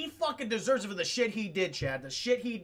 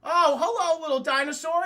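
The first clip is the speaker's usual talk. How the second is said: high, loud pet-greeting voice; mock cooing greeting; 7 semitones higher, 6 dB louder than usual. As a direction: greet it like a cute little animal. Acting Newman greeting small dinosaur.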